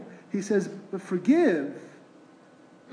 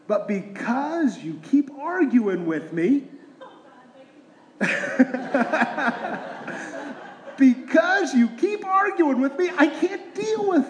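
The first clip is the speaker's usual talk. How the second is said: laughing through it; 5 semitones higher than usual. Retold or imitated. Parent voiced in story.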